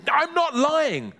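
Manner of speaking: high, stretched protest; boy's desperate high denial; crying out. Acted. Boy backs away from accusing neighbour, high cry. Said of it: "cried Ben".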